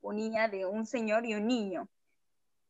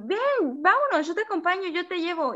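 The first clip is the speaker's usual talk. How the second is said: pushy stranger; 7 semitones higher than usual. Retold or imitated. Stranger urging her to come.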